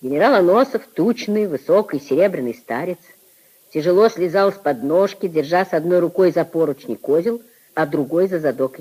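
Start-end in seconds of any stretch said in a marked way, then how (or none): none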